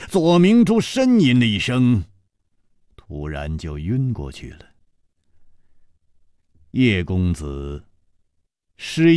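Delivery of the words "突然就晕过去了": usual speaking voice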